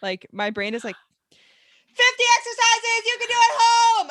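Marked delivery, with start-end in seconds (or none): 1.9-4.1 high, very loud yelled headline; yelling. Acted. Podcaster mocking caps-lock titles, yelling at the screen. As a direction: shout it out like text in all caps. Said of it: "without yelling at the screen".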